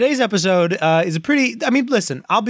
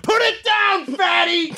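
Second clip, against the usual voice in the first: high shrill-free shouted scolding; mock yelling; 14 semitones higher, slower than usual. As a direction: yell it like a mean coach. Yelling at pretzel-eating cohost.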